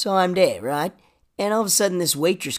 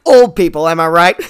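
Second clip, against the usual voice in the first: mock stand-up comic; 10 dB louder than usual. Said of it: "this stand-up where I just get up there".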